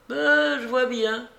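high-pitched, contained anger; quiet rage. A narrator's reading. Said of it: "avec une sorte de rage tranquille". (rage tranquille, voix aiguë)

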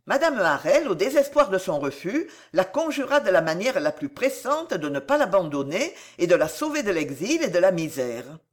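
ordinary delivery, nothing marked

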